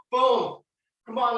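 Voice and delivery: normal talking voice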